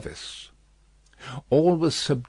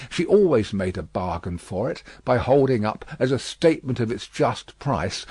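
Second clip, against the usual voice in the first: ordinary delivery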